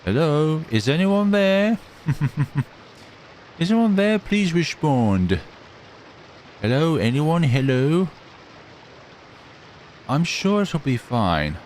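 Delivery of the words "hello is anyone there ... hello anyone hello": calling for help. Acted. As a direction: shout up hoping someone hears. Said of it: "Yell for help!".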